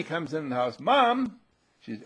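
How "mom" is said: put-on high, loud child's holler; acted boy's call; calling for Mom. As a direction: shout for Mom like a kid indoors. Voicing boy calling in house.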